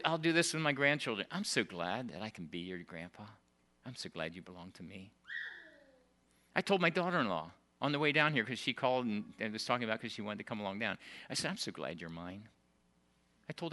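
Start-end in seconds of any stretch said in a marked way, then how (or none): 1.3-5.1 soft, lower and gentle; tender grandpa. Retold or imitated. Grandpa to his grandchildren, tender and soft. 11.5-12.5 soft and low; tender. Retold or imitated. To his daughter-in-law, soft and tender.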